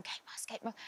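hushed whisper. Retold or imitated. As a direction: whisper it like gossip. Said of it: "other people are going".

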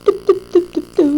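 high, playful "tıp tıp" sounds; imitated noise. Player filling a pause in the game, high mouth noises. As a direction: tap out high-pitched tıp-tıps with the mouth.

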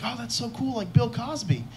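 put-on high, soft, wide-eyed kid voice; hopeful eighth grader. Eighth grader admiring his future self's job, high and softly awed. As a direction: marvel softly like an impressed younger self. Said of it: "That poor little chubby ball of hope".

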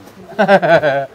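loud burst of laughter; hearty laugh. Real, not acted. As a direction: laugh out loud in quick pulses. Laughs after asking to lie down. (malakas na halakhak)

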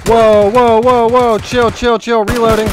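real frantic calling out; panicked shouting. Real, not acted. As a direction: shout to calm the chaos while reloading. Panicked mid-firefight.

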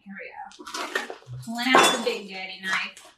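normal talking voice

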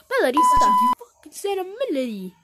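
high, loud incredulous yell; outraged shout. Acted. Shouting at the rival over a melody, high and loud. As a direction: explode at the bad melody.

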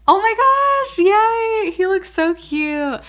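very high, loud squeal easing down; high-pitched fan excitement; overjoyed squeal. Real, not acted. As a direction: squeal with delight, then gush. Pulls a card she loves.

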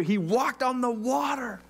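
slow, high-pitched exclamation; drawn-out, amazed objection; incredulous protest. Acted. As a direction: stretch out every word in playful disbelief. Repeats his amazed protest.

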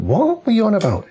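high, incredulous "what"; retelling the moment of utter disbelief; shocked disbelief. Retold or imitated. Retold shock at the accusation.

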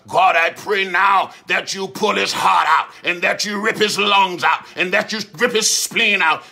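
loud shouted curse prayer; fierce African prayer. Retold or imitated. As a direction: imitate a prayer that scares the hell out of you. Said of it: "they scare the hell out you with their prayers".

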